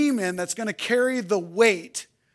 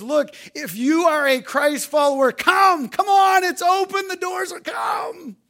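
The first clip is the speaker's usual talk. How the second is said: high, loud, fervent calling out; shouted invitation; 7 semitones higher, 4 dB louder than usual. Acted. God calling believers into the throne room, shouting. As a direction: call them in at full voice. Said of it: "he says".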